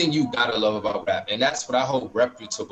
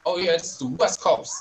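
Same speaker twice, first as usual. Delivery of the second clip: put-on West Coast accent; 7 semitones higher than usual. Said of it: "like, the accent".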